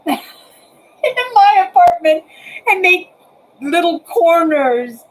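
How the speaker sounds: high, laughing excitement; laughing lift. Real, not acted. Excited musing about new webcam setup.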